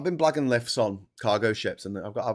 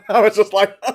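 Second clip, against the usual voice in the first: laughing hard, high and loud; real laughter; 11 semitones higher, 12 dB louder than usual. Real, not acted. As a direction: crack up at the unbelievable luck. Laughs at the coincidence.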